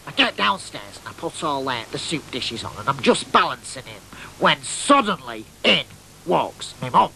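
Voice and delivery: normal talking voice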